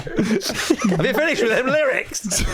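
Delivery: put-on high, whiny kid begging for a turn; pleading kid. Child begging a sibling for the lyrics, high and whiny. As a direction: beg like a child wanting the lyrics back.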